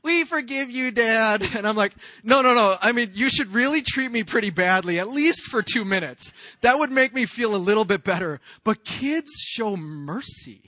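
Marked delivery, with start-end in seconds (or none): 0.0-1.4 put-on high, loud sing-out as a child; imitated children's voices; forgiving kids. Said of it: "kids are so easy. They're so forgiving". 2.2-8.4 loud, raised-pitch pleading objection; hammed-up dad protesting; mock protest. Acted protest to forgiving kids.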